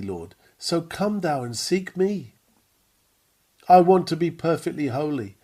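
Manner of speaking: ordinary talk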